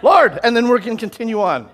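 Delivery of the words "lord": high, loud one-word appeal; loud cry to God. Retold or imitated. Preacher showing the congregation what to pray, calling out. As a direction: call it out as a demonstration prayer. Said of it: "He just said".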